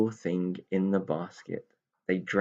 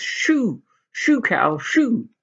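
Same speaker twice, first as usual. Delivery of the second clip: much higher, louder shoo; shooing shout; 14 semitones higher, 7 dB louder, much slower than usual. Acted. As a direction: chase the cow off. Shooing cows away.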